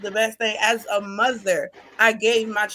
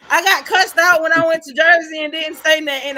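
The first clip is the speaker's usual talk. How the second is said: higher, louder telling; emphatic outburst; 5 semitones higher, 6 dB louder than usual. Real, not acted. Telling friends how dad cussed her out, emphatic. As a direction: stress the scolding with a laugh. Retelling being scolded, emphatic.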